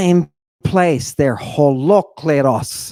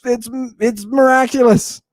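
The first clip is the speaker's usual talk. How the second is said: high, loud, frantic protest; hammed-up yelled denial, pitched up high; mock screaming; 7 semitones higher, 3 dB louder than usual. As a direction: imitate a man screaming his innocence. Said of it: "he's already been screaming".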